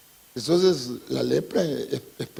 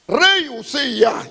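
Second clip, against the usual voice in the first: slow, louder and higher call of the king's name; solemn loud summons; 7 semitones higher, 5 dB louder, slower than usual. Acted. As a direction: summon the king to account. Priests confronting the king.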